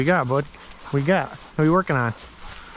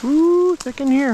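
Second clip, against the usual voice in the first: high drawn-out ooh; 11 semitones higher, 4 dB louder, slower than usual. Real, not acted. Pushing through thick cover.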